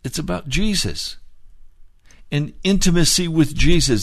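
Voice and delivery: rising revival preaching, urging them on; fiery preaching. Acted. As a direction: push it home to the congregation, loud and high. Preaching at full force.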